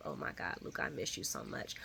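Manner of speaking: breathy mock whisper of grief; whispered longing. Vlogger imitating Tracy over the snow globe: hushed mock whisper. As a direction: hush it like a sad secret. Mimicking Tracy missing her son.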